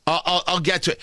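usual speaking voice

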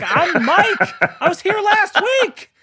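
high-pitched indignant outcry; exasperated protest. Acted. Patient reminding stoned front desk staff who he is, exasperated. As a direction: cry it out in mock exasperation, voice leaping way up.

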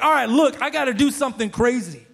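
high, loud mock exasperation; fed up. God's last resort to reach you, imitated fed-up voice. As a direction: act out running out of patience. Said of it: "so fed up with you".

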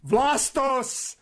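name called out high and bright with delight; excited recognition. Real, not acted. Host greets the guest's answer with excited recognition. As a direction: pounce on the name, thrilled to hear it.

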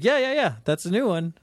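imitated quick, high-pitched assurance; retold offhand insistence; breezy reassurance.

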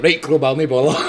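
laughing through the words. Retold or imitated. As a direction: giggle out the reply. Retold nervous reply, laughing.

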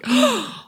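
high startled gasp; sharp gasp. Retold or imitated. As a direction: gasp in sudden realization. Realizing they forgot Sharon.

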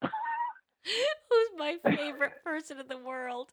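high, slow, helpless giggly delivery; laughing. Real, not acted. Host delighted by comments from a listener, laughing. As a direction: squeeze the words out through laughter. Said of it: "what we are laughing about".

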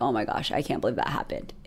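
retelling a low moment of despair, hushed and deflated; despairing murmur. Retold or imitated. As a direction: let it fall away, close to tears. On kitchen floor wanting to cry.

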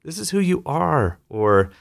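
high, coaxing pep talk; encouraging leader. Retold or imitated. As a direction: lift them up warmly. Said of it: "I'll tell people, like".

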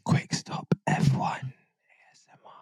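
giggly prank whisper, close to mic; breathy ASMR whisper for a joke. Pretend.

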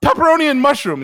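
high, loud, frantic order; desperate blurt. Retold or imitated. Caves in to dad's scorn.

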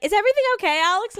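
high, loud checking-in voice; imitated concerned therapist. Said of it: "my therapist is like".

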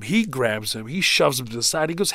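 ordinary talk, nothing marked

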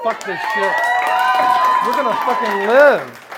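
building from firm to a loud triumphant cry; defiant resolve. Retold or imitated. Deciding to accept himself.

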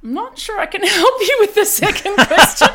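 laughing through the words; amused laugh while speaking. Real, not acted. Laughs at host's infomercial joke.

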